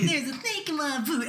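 high-pitched toy catchphrase imitation; gamine qui imite. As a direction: squeal it like a little girl quoting a toy. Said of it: "and then she's like".

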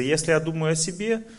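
ordinary talk, nothing marked

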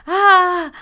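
high, loud "ah" yell; sudden mock scream; imitated student scream. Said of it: "o te gritan y te hacen".

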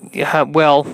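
ordinary talk

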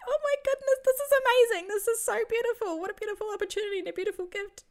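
high, loud, rushing burst of delight; overjoyed gasp. Retold or imitated. Telling how she reacted to Jesus's gift, thrilled. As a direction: gush like you've just seen a miracle. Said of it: "my reaction was like".

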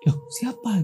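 "Loh, siapa?" said aloud with a high, puzzled lift; puzzled voiced "Loh, siapa?". The look-alike's puzzled question to Hasan, voiced. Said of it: "Cuman pakai ekspresi".

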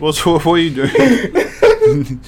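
loud, slow protest through laughter; laughing exasperation. Real, not acted. Host to friend who botched the name, laughing exasperation.

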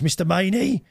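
high mock call of a name; imitated shouting. Delivery man calling into the doorbell, imitated shout. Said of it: "he shouts down the".

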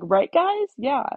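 loud, high teasing jab; mocking teasing. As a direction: tease them in a singsong. Classmates mocking the silent seniors.

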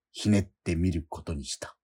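ordinary delivery

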